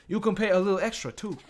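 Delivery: high, loud mock-Chinese accent; put-on Chinese accent. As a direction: pitch it up into a caricatured Chinese accent. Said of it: "I should be talking like this".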